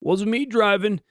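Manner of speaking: put-on drunk denial. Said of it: "jumped into the back seat like".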